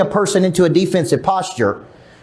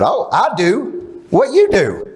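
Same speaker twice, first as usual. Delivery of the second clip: slow, higher, clueless reply; mock Larry; 3 semitones higher, much slower than usual. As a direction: play him dim and unsure. Imitating Larry's answer.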